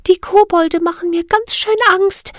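very high, fearful little fairy; trembling voice. Acted. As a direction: quaver it like a scared tiny fairy. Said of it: "sagte Siri mit zitternder Stimme".

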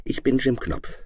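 put-on light, steady boy's answer; calm boy. A narrator's reading. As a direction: introduce yourself calmly as a boy. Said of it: "antwortete Jim ruhig".

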